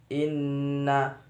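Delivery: "Inna" with a long hummed double n; ghunnah. Shown as a demonstration. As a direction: hold the nasal nn in "Inna". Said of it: "bacaan gunah". (baca "Inna" dengan nun didengungkan)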